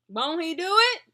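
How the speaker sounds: high, loud church-style call of praise; dramatic praise shout. Acted. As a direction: belt it out like testifying in church. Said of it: "I get why they're dramatic".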